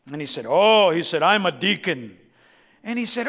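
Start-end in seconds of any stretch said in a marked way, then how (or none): 0.5-0.9 lightly voiced somber church man, louder; a touch of pompous sourness; sour deacon. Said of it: "somber-looking man who looked like he'd been weaned". 1.2-2.2 lightly voiced somber man, a little lower; a hint of dill-pickle sourness; sour deacon. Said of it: "weaned on a dill pickle".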